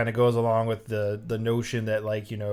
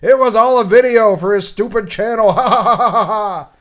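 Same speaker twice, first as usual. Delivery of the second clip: high, loud hammed-up gloating laugh; mock laughter at the end of a prank; 7 semitones higher, 8 dB louder than usual. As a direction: cackle at the fool who got pranked.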